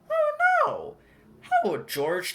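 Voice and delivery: high, loud squeal of dismay; alarmed cry. A narrator's reading. Narrator crying out as the cards scatter, high-pitched alarm. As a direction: gasp it out as the cards fly off.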